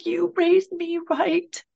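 high, choked-up voice of her son crying on the phone; retold crying. Son calling mother from jail, tearful and high. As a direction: let the voice rise and tighten as if fighting tears. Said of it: "and then he starts crying".

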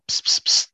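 airy unvoiced psst psst psst, like a nurse tipping off the doctor; mock conspiratorial whisper; retold whisper. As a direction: hiss it secretly into an ear. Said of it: "whispered in his ear".

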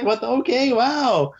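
high, baffled what-the voice; baffled surprise. Retold or imitated. Friend baffled by a weeping stranger's hug, high and surprised. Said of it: "He's just like".

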